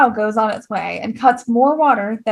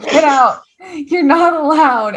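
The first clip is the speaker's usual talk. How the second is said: loud, higher-pitched mock shout; mock scolding; 6 semitones higher, 5 dB louder, slower than usual. Virgil pushing Filippo off the boat, mock scolding. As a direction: order him off loudly, stretching the last words.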